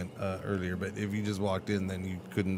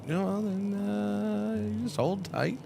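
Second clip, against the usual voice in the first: high goofy guide voice; 12 semitones higher than usual. Pretend. Streamer introducing himself to viewers as goofy guide Gringer, squeaky high. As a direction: play a silly squeaky know-it-all guide.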